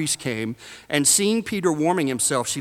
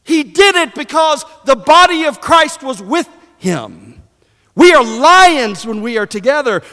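high, loud, shouted preaching; full-force preaching; 13 semitones higher, 9 dB louder than usual. Acted. Preacher on lions together and mice alone, full-force preaching. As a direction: preach it at the top of your voice.

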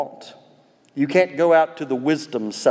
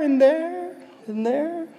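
imitated echo; 13 semitones higher, slower than usual. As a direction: echo the call, fading out.

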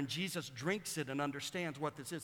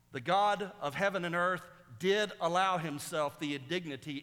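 usual speaking voice

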